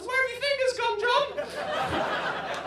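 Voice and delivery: high, loud, panicked shriek; teenage son shrieking at his dad in the car; panicked scream. Retold or imitated. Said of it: "seventeen-year-old Ed screamed the words".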